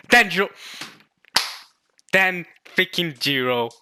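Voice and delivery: loud, astonished outburst; excited shout. Real, not acted. Reacting to a shock arrival, real loud shout. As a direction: shout it out in disbelief. Stunned by character's return.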